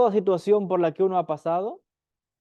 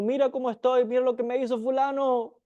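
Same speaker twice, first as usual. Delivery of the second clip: whiny victim lament; 6 semitones higher than usual. Retold or imitated. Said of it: "le dicen al mundo".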